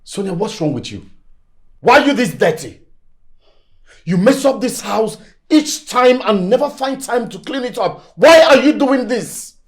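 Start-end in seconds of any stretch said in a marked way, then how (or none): 1.8-9.6 exasperated, raised-voice telling-off; angry scolding. Acted. Man berating Sonia over messy house, angry. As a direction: scold hard, building to a loud last question.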